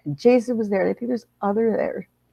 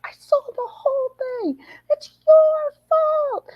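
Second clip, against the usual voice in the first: crying scream. Retold or imitated. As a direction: shriek it through tears, accusing her. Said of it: "I went out there screaming, screaming".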